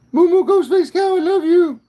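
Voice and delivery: very high, loud sing-song call to the cow. Real, not acted. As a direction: call out to her high and loud, full of affection. Calling lovingly to a cow.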